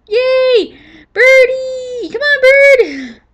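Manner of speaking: high, loud calling to the bird; excited pet call. Real, not acted. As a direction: cheer the parrot over, slowly.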